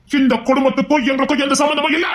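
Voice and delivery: high-pitched angry outburst. Acted. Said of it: "ஏன் மாமா கோபப்படுறீங்க?".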